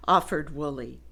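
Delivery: usual speaking voice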